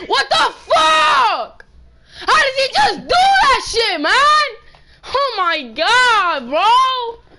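high, loud, slow furious yell; furious shout. Real, not acted. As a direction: yell at the screen in disbelief. Gamer raging at opponent.

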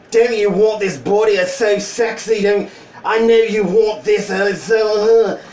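loud, hammed-up British accent. Sexy dude showing off his body online, mock British accent. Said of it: "Apparently he is from the U K".

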